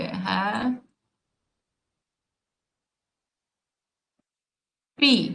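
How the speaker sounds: है, long pause, then पी as its own beat; split into syllables. Shown as a demonstration. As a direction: say है and पी as two separate syllables. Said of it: "happy को आप हिंदी में कैसे लिखोगे".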